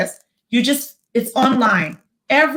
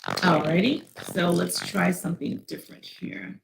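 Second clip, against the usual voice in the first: robotic distortion; 5 semitones lower, 8 dB quieter than usual. Real, not acted. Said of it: "you sound a little distorted".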